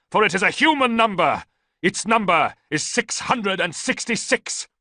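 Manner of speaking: loud, high chanting, slowing at the end; ranting prophecy. A narrator's reading. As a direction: chant the number of the beast. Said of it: "Theodotus continued to rant".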